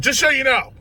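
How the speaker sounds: high, loud blurted warning; urgent warning. Retold or imitated. Patient warning the nurse before the shot, high urgent blurt. Tells nurse while being injected.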